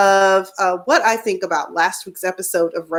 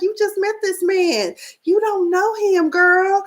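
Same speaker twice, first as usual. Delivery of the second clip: incredulous; 10 semitones higher than usual. Retold or imitated. Retold disbelief at Alexis.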